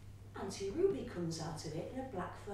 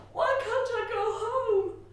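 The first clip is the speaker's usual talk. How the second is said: anguished plea; 11 semitones higher, 11 dB louder than usual. A narrator's reading. Child's anguish.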